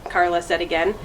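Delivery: usual speaking voice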